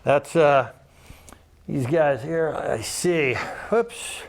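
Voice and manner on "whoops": ordinary delivery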